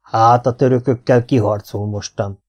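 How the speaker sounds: ordinary delivery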